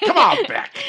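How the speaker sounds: high mock coaching cheer; imitating self-talk. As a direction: egg yourself on at the race.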